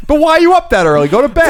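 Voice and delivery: exasperated. Real, not acted. Exasperated complaint about early laundry.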